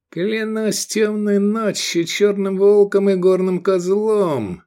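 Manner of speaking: high, slowly building angry oath; forced-out oath. A narrator's reading. Sheriff swears as his honour is challenged, angrily. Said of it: "процедил Рахаль".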